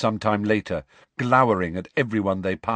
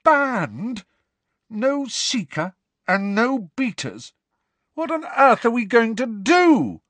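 high, slowed disbelief, a touch louder; Angelina, stunned; 6 dB louder, slower than usual. A narrator's reading. Captain Angelina to her team after the ban, incredulous and high. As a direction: voice the shocked captain, lifted high and drawn out. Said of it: "said Angelina in a hollow voice".

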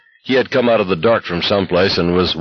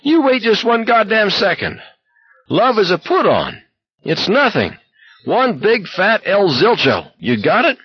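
high, shrill ranting. A narrator's reading. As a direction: rant like breaking glass. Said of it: "His voice was as shrill as broken glass".

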